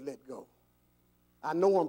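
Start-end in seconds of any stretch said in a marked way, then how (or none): 0.0-0.5 low, very soft divine urging; quiet, lowered instruction; hushed command. Acted. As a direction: say it gently and quietly, as God. God's words repeated softly.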